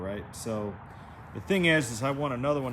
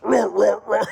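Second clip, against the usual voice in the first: loud, high dinosaur roars; T-Rex roar imitation; 9 semitones higher, 12 dB louder, slower than usual. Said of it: "a T-Rex".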